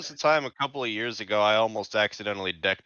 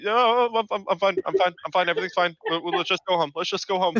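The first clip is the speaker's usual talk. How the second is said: put-on high, nervous kid insisting he's fine; scared kid pretending all is fine, pitched up; shaken kid; 7 semitones higher, faster than usual. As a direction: stammer it like a spooked child.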